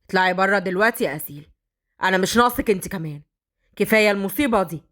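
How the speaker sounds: low, curt angry order; angry dismissal. Acted. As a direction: push her away with irritation. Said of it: "فهد اتعصب".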